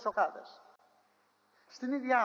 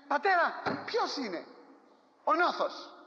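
urgent sons' question; 7 semitones higher, slower than usual. Acted. Sons demand of dying father.

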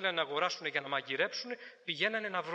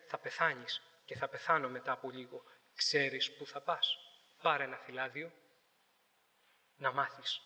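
solemn warning; 6 semitones lower than usual. Retold or imitated. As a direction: speak gravely, eye to eye, about death. Church youth warning passersby.

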